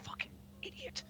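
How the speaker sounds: whispered insult under her breath; angry whisper. Acted. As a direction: hiss it to yourself in disgust. She shakes her head in anger.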